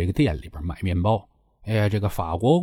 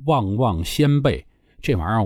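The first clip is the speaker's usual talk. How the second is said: ordinary talk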